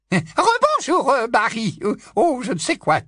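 put-on high, loud old busybody voice; high loud tipsy old aunt gushing; old aunt Muriel. Muriel greeting Harry.

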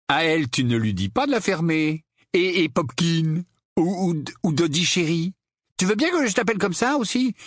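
high, mock-sweet needling; taunting Harry. (railleries mielleuses, voix haut placée)

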